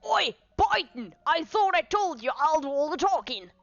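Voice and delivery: high, indignant telling-off in character; acted angry inspector. Inspector scolds his talkative constable, mock outrage. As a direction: pull the constable up short.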